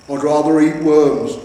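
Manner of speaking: loud, slow defiant grumble; grumbling troll. A narrator's reading. Troll husband snapping back at his wife, loud. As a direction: dig in stubbornly.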